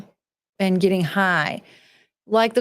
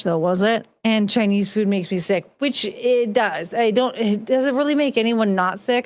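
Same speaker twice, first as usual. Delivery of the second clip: ordinary delivery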